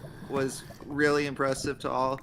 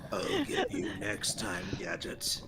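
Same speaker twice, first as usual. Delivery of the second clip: Doctor Claw; 7 semitones lower, 6 dB quieter, slower than usual. Retold or imitated. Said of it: "Doctor Claw".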